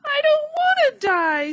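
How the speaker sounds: a piglet screaming on the ground. A narrator's reading. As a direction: throw it out in full panic. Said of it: "screamed Wilbur".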